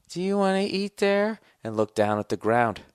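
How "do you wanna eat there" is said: high, sad mock girlfriend voice; whiny girl fishing for a better offer. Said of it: "why would she say".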